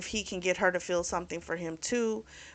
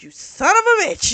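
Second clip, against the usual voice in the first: high, loud mock outrage; retold angry outburst; 12 semitones higher, 8 dB louder than usual. Retelling Mason confronting Liam, high and loud mock fury. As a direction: act out an angry curse, pitched right up.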